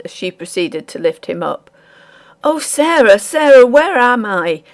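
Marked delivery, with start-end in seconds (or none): 2.4-4.6 high, loud, slowing plea to take the weight off; cling to her in panic; the terrified miller begging the housekeeper; terrified cry. A narrator's reading. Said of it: "cried the terrified miller".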